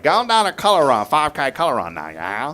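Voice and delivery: loud, high-starting mock Cajun; playful Cajun announcement; Louisiana Cajun accent. Shown as a demonstration. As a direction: demonstrate a hammed-up Cajun. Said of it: "Louisiana Cajun accent".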